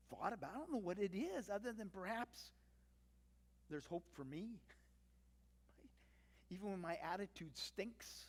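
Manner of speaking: ordinary delivery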